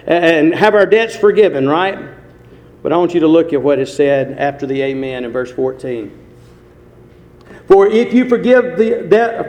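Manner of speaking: loud, driving pulpit delivery; full-force preaching. Real, not acted. Preacher expounding the prayer in Matthew six, full-force preaching. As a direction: proclaim the scripture forcefully to the room.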